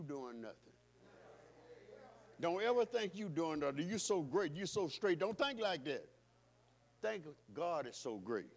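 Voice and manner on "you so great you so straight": ordinary talk, nothing marked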